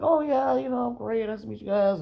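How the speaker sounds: high, overly cheery fake politeness; fake polite. Awkward at the table, he answers the friends in a high, forced cheerful voice. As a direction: put on a bright polite front for strangers.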